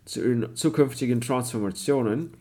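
German read in a put-on English accent; a lightly faked English accent on German; pseudo-English accent. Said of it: "redest mit pseudo-englischem Akzent".

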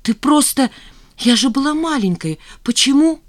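high, confused protest; flustered. A narrator's reading. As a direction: defend yourself in confusion. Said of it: "пробормотала Жанна в замешательстве". (растерянно оправдываясь)

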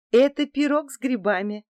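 high, loud, bright announcing; joyful announcement. A narrator's reading. Daughter proudly presenting her homemade mushroom pie. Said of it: "радостно объявила она".

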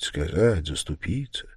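plain reading voice

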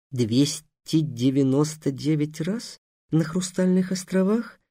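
hoarse disbelief. Acted. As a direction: repeat it quietly, not believing it. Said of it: "просипел Гавар". (сиплое тихое недоверие)

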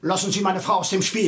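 stern angry warning. Acted. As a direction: cut him off, protective of his wife. Husband defends wife, warns inspector.

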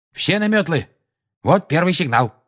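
high, abrupt command. A narrator's reading. Lupin spots the first signal in the sky, abrupt. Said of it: "отрывисто произнёс Люпин".

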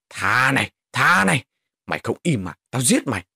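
loud, menacing outburst; angry threat. Acted. Attacker threatening a pleading child, angry and loud. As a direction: throw the words out angrily, loud at first.

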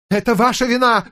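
ударение на «ваша», зло и высоко; бросай обвинение с нажимом на «ваша»; гневное обвинение. A narrator's reading. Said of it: "сорвался на крик". (гневно, с нажимом)

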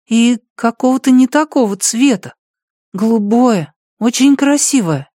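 put-on high child's voice; small boy telling a dream; little boy voice. Boy tells his dream.

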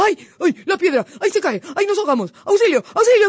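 loud, high panicked yelps; hammed-up fox in terror; frightened shouting. A narrator's reading. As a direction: scream for help as if jolted awake. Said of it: "se puso a gritar asustado".

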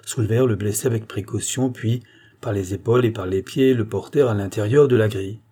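ordinary delivery, nothing marked

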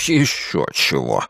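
low, slow snort of refusal; deep, scornful huff; snorting dragon. A narrator's reading. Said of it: "фыркнул дракон".

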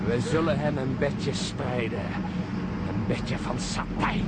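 mocking. Peter speaks mockingly about John.

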